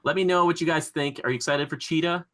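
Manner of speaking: ordinary talk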